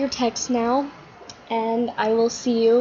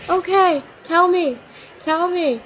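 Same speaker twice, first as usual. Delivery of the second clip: eager pleading; 8 semitones higher than usual. Acted. Todd eager for the secret.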